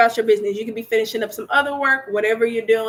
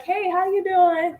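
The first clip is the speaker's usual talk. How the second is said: friendly greeting; 8 semitones higher, slower than usual. Retold or imitated. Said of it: "they're like".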